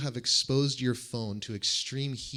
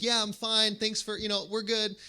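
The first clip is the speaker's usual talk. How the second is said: higher, louder call down the hallway; calling after; 9 semitones higher, 4 dB louder than usual. Retold or imitated. Calling after the Brit halfway down the hall, loud. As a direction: shout the answer after someone already gone.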